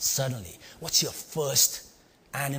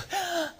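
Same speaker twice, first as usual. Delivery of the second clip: a sharp gasp, pitched up; big man gasps in shock, high. Retold or imitated. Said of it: "I saw this guy go".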